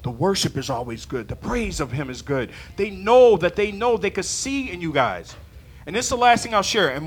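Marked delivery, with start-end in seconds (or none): 0.0-2.5 soft, homey praise; fond visitor quote. Retold or imitated. Preacher quoting returning visitors, soft contented praise. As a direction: lower and much softer, content. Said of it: "comes back and says".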